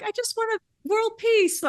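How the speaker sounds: high, far-off pageant-queen voice; Miss America. Retold or imitated. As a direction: play a beauty queen gushing her wish. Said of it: "you know, Miss America would say".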